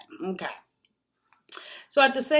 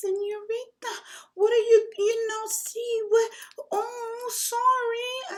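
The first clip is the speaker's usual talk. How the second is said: imitating a Mexican man's broken English, much higher voice; broken English Mexican accent. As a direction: put on the Mexican guy's accent, high and eager. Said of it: "he's talking to me in his broken English".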